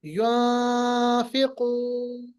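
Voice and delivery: युनफिकून drawn out very slowly with the noon hidden; ikhfa demo. Shown as a demonstration. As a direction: hide the noon sound, stretch it long. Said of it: "नून की आवाज को छुपाना है". (इख़्फ़ा के साथ बहुत धीमा युनफिकून)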